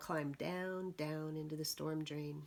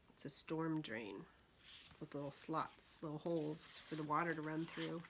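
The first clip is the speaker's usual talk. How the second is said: plain reading voice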